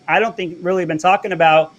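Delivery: ordinary talk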